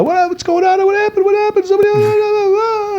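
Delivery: squealed high mock panic, tumbling into babble; feigned cluelessness. Son in the kitchen playing innocent, high mock panic. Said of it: "and I'm playing the".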